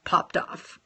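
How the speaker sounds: whispered popped off. Acted. Telling viewers the nail popped off, whispered. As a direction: breathe the words out unvoiced. Hushed punchline again.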